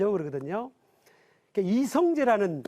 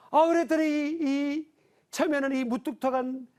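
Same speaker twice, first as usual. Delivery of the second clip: ordinary talk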